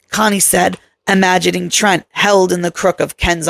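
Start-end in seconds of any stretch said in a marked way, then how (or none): none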